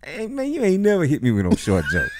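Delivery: high-pitched objection breaking into laughter; laughing protest. Real, not acted. Man teased with a short joke, laughing protest. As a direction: object while cracking up.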